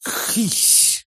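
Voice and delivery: sharp voiceless hiss; creature hissing. A narrator's reading. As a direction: hiss like an angry little creature. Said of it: "прошипела Мелочь". (сердитое шипение зверька)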